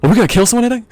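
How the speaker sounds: high, startled whoa, half joking; alarmed disbelief. Retold or imitated. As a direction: act shocked at your friend's plan. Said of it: "I'm like".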